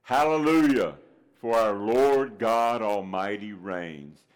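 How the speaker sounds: slow, booming call for all to repeat; leading refrain. Shown as a demonstration. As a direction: show the congregation how to call it out. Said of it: "Say it with me".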